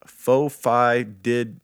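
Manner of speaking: very slow, syllable by syllable; sounding out a name. Shown as a demonstration. Host carefully sounds out a strange reviewer name, very slow. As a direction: spell out each odd chunk of the username slowly. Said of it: "Nailed it".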